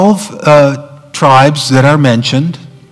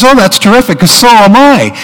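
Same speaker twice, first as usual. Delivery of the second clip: high, raised-voice mock enthusiasm; hammed-up delight; 7 semitones higher, 4 dB louder than usual. Man thrilled his new friend matches, hammed-up delight. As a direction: play overjoyed at the match, building to a punch line. Delight builds toward the punch line.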